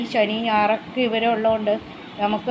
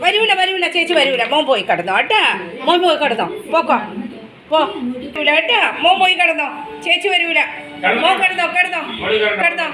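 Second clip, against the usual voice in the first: pet-talk voice; 5 semitones higher, 4 dB louder than usual. Real, not acted. Mother sending her pet off to bed, fond scolding. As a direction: shoo the little one off, half scolding, half doting.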